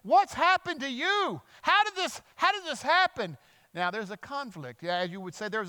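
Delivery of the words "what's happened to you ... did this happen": very high, amazed questioning; astonished. Retold or imitated. People see the healed leper passing, astonished. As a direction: exclaim in high-pitched disbelief.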